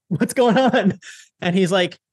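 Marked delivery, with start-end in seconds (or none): 0.1-1.0 acted winded, high-pitched question; high mock-breathless phone voice; out of breath. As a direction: imitate asking while gassed. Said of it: "I'm, like, out of breath".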